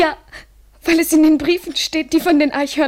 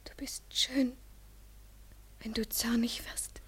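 whisper; 3 semitones lower, 16 dB quieter than usual. Acted. Lovers' intimate reply.

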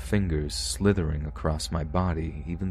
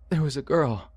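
put-on high, tearful child's voice; sobbing child; 9 semitones higher than usual. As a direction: choke it out through sobs. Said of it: "he said through his loud sobs".

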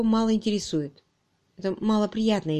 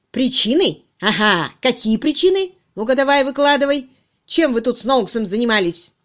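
loud, sharp, suspicious questioning; aggressive, high-pitched grilling; pouncing interrogation; 5 semitones higher, 8 dB louder than usual. Acted. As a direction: seize on the slip triumphantly. Chief pounces on suspect's slip.

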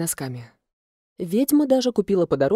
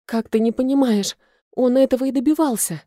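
higher-pitched desperate plea; desperate protest; 6 semitones higher, 4 dB louder than usual. A narrator's reading. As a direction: plead in exasperated despair. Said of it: "повторяла я в отчаянии". (с отчаянием, на повышенном тоне)

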